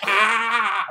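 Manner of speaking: high squealed Ah; mock high yelp; high mock yell. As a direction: yelp it like a cartoon wrestler. Imitating Mankind's yell.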